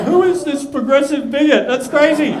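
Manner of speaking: high, loud disbelief; astonished. Acted. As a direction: exclaim it in mock amazement. Acted astonished reaction to the bikie.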